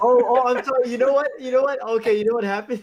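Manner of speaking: high, giggly, laughing through words; can't stop laughing at a video. Real, not acted. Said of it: "so I'm laughing at the YouTube video".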